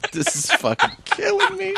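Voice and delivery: cracking up mid-sentence; laughing too hard to talk; words squeezed out through a high laugh. Real, not acted. Laughing fit over bad audio.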